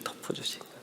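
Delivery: soft unvoiced repeat of the phrase; hushed whisper, letting it sink in; whispered echo. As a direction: breathe 덮어주신 거예요 as a hushed echo. Whispered echo of previous line.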